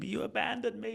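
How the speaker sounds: high-pitched cry of reproach, held back in volume; mock scream. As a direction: accuse God in a high, strained mock yell. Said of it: "screaming at God".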